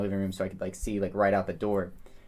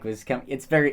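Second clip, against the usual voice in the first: ordinary talk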